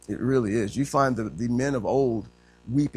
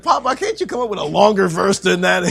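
higher, louder mock complaint; protesting grandchildren; 7 semitones higher, 8 dB louder than usual. Grandchildren teasing grandfather over grace, mock protest. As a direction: plead with Papa, playfully exasperated. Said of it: "We're like".

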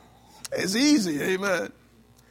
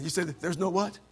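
ordinary talk, nothing marked

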